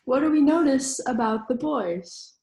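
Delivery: ordinary talk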